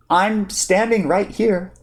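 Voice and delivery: drawn out, mildly offended. Acted. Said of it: "the tone still blue-eyed, but veering toward offended".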